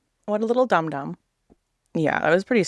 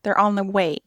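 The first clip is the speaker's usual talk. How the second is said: retold yell; 6 semitones higher, 4 dB louder than usual. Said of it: "He yelled at me".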